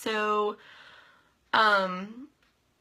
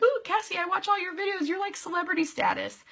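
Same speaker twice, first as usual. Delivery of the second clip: starstruck fangirl; 11 semitones higher than usual. Retold or imitated. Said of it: "I'm like".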